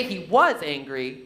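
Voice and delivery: "he was angry" with "was" punched; stress on WAS. Shown as a demonstration. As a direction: lean on "was", implying no longer. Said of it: "it means now he's not angry".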